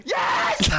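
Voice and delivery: loud, whooping imitation of a cheer; mock triumphant shout. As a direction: shout it out like a gleeful celebration. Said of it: "you went".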